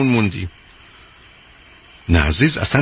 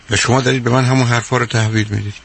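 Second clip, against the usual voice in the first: normal talking voice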